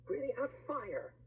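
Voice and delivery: ordinary delivery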